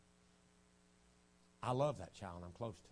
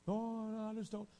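mimicked denial; 12 semitones higher, 4 dB louder than usual. Girl denying bitterness, retold.

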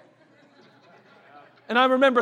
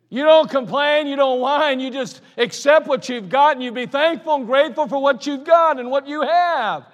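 fervent preaching; 6 semitones higher, 8 dB louder than usual. Real, not acted. Preacher driving home contentment to his congregation. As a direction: preach it with full conviction. Preaching the lesson at full force.

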